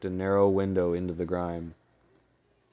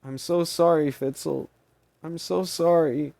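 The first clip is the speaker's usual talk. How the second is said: tearful; 10 semitones higher, 6 dB louder than usual. Acted. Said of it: "Maney wiped the tears from her eyes".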